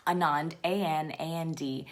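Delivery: each letter said separately; spelling aloud. Shown as a demonstration. Host spelling an author's name for a search, letter by letter. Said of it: "let me try to spell it".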